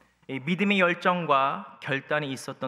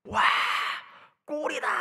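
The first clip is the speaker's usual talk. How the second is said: high-pitched cheer, not at full volume; mock distant cheer; 14 semitones higher, 3 dB louder than usual. People outside shouting at a goal, imitated cheer. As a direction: imitate a crowd yelling from outside. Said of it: "밖에서 사람들이 소리를 지르는 거예요".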